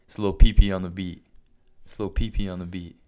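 producer tag, loud at the start. Retold or imitated. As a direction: announce it like a beat tag. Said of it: "producer tags at the beginning of songs".

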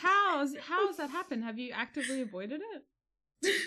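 shocked. Real, not acted. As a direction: react in disbelief. Shocked at never seen Matilda.

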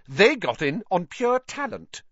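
high-pitched pointed comeback; indignant rebuke; sharp retort. A narrator's reading. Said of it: "said Hermione sharply".

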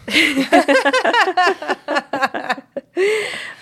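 long amused laugh; real laughter. Real, not acted. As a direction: crack up at a silly joke. Laughs at pocket ramen joke.

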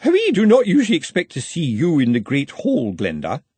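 high, loud, severe housekeeper. A narrator's reading. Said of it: "a severe voice behind her said".

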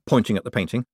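ordinary delivery

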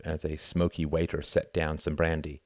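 ordinary delivery, nothing marked

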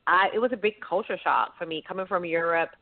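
ordinary delivery

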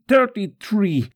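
loud, high cry of pain; pained outburst amid prayer; agonised count. Acted. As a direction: shout the count as the whip lands. Counting lashes during the scourging.

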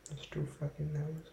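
ordinary delivery

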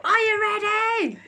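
high, hammed-up pump-up call; hyped-up coach voice. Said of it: "It's like".

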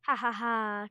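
loud, spoken ha-ha-ha; staged victory laugh; pretend gloating laugh. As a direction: laugh triumphantly in mock victory. Clay gloats as pretend scavenger.

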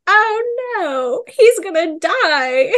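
high, slow, pained cry; mock anguished wail. Reader realizing a beloved character will die, high and pained. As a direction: wail it as if your heart just sank.

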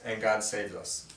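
ordinary talk, nothing marked

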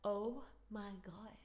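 hushed, amazed whisper; awed whisper. Retold or imitated. Teacher amazed by fake French, whispering. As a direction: whisper it in disbelief. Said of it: "she was like".

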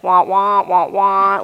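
loud, muffled wah-wah like distant voices; muffled adult talk. Retold or imitated. Telling the audience how friends sounded while she panicked, loud wah-wah. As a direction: imitate everyone's talk turning to noise. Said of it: "the world's kind of spinning and it's like".

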